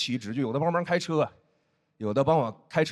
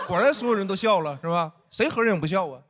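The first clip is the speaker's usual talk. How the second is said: ordinary talk, nothing marked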